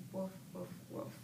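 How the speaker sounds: soft, hushed woof woof; whispered bark. Shown as a demonstration. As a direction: bark as if the baby is sleeping.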